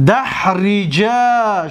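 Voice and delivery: دحرجا drawn out slowly with a long final a; slow Arabic demo. Shown as a demonstration. Teacher demonstrating the dual form, slow and deliberate. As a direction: stretch دحرجا, holding the ending. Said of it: "اکرما".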